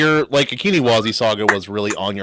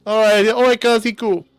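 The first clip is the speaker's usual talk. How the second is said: Hawaiian pidgin; 12 semitones higher than usual. Acted. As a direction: put on a high-pitched Hawaiian pidgin voice. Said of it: "I could speak a little pidgin".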